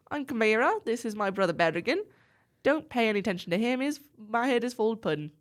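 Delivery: put-on amateur Aussie accent for a friendly sister; attempted Australian accent. Sister introduces her brother, attempted Australian accent. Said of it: "I'm gonna give it a shot".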